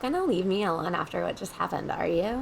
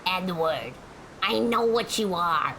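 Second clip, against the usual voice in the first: Gilbert Gottfried impression; slower than usual. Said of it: "That's my best impression".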